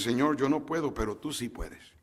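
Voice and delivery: soft, low surrender to God; hushed confession of weakness and trust; quiet prayer. Pretend. Believer surrendering to the Lord in a soft, hushed voice. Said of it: "Cuando dices".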